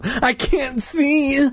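slow, high-pitched panicked wail; drawn-out high cry, no extra volume; shouting. A narrator's reading. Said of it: "Luke shouted".

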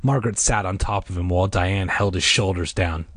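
ordinary delivery, nothing marked

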